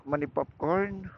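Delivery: ordinary talk, nothing marked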